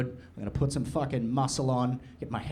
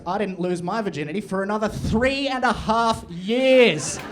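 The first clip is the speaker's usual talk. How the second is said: normal talking voice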